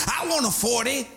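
high-pitched mock lady voice; eager female recruits. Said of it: "That's what the ladies say".

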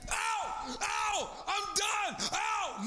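hammed-up wailing of grief; mock wailing. Mocking loud grief outbursts.

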